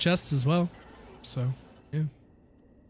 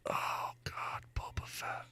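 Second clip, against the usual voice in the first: unvoiced whisper of despair, much softer; whispered groan; 17 dB quieter, slower than usual. Real, not acted. Streamer groaning about Boba Fett, whisper. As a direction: breathe it out like a pained whisper.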